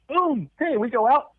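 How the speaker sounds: high, sudden shout of the boom; a burst of high-pitched storytelling exclamation; sudden mock exclamation. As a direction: act out being flung out, pitch leaping way up. Sudden launch acted out in story.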